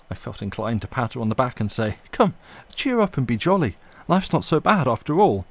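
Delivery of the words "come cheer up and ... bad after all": high, loud jolly encouragement; hearty cheer. Retold or imitated. Narrator imagining cheering up the weary landlady, jolly and loud. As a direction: buck her up heartily.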